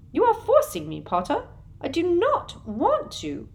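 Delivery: high, prim, slowing on the last words; a sugary official feigning regret; Umbridge voice. A narrator's reading. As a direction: Umbridge blaming Harry. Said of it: "said Umbridge".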